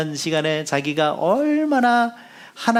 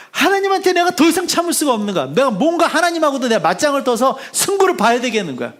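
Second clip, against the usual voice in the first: fed-up outburst; 7 semitones higher, 4 dB louder than usual. Retold or imitated. Son's furious inner rant.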